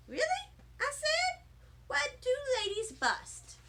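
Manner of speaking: put-on high, amazed little girl; squeaky astonished kid; surprised child. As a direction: gasp it out with wide-eyed wonder. Surprised child asks Nana.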